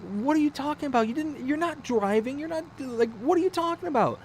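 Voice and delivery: high, fast protest; exasperated, high-pitched rapid questioning; incredulous. Retold or imitated. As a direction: sputter in baffled disbelief. Husband argues with wife's claim.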